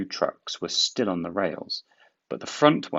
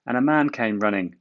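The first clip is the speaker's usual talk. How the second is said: plain reading voice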